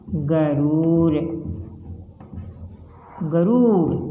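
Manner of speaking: गरूर with रू pushed harder; stressed long u. Shown as a demonstration. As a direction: lean on the रू in गरूर. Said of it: "इसको थोड़ा जोर लगाकर बोलते हैं". (बड़े ऊ पर जोर लगाकर गरूर)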